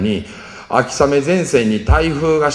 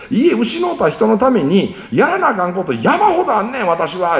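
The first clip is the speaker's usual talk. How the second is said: 1 tearful scolding; 9 semitones higher than usual. Retold or imitated. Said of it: "泣きながら電話で僕に大声出して怒ってきて".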